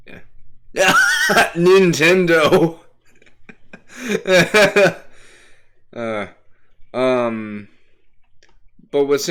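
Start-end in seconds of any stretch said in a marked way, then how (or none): 1.0-1.5 high laugh. Real, not acted. Bursts laughing at own joke. 1.5-2.8 excited shout. Acted. Exclaims mock-excitedly, laughing. 3.4-5.2 laughing. Real, not acted. Laughs after the shout.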